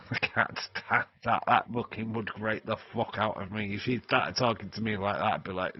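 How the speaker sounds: ordinary talk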